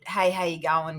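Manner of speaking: low, soft Australian "how you going"; good Australian greeting. Shown as a demonstration. Guest coaching the host on slang, Australian accent. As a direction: model the Australian greeting casually. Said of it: "I haven't heard a good".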